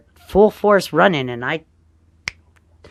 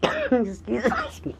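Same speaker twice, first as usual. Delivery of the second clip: choking cough, higher pitch; coughing fit; much slower than usual. Real, not acted. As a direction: cough hard, as if choking on air. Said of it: "I'm choking on air".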